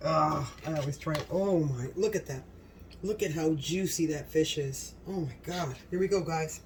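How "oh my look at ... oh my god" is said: ordinary talk, nothing marked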